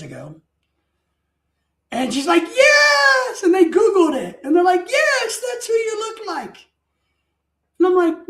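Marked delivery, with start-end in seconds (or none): 2.4-3.4 high, loud imitation squeal; drawn-out high cry of recognition; excited exclamation. Nurse recognising the lookalike, retold. 4.9-6.6 imitating an excited squeal; high-pitched mock delight; excited confirmation. Excited staff confirming, retold.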